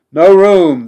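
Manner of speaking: loud, high refusal; shouting. A narrator's reading. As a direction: bark the refusal. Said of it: "the sailors shouted at him".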